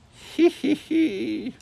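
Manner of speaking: high creepy granny giggle. A narrator's reading. Granny in the hall giving a creepy high giggle. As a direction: giggle like a lurking monster. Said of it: "Someone was laughing in the hall".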